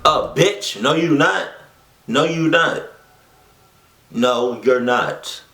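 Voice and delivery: play-acted indignant "absolutely not"; sassy refusal. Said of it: "That's what I would've said".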